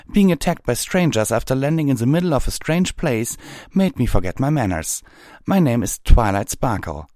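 put-on high, loud young mare's voice; indignant Twilight. Twilight introduces herself to the captain, high and loud.

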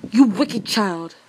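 loud, drawn-out scolding; enchantress's angry cry. A narrator's reading. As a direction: cry out as an outraged enchantress, stretching the words. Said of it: "cried the enchantress".

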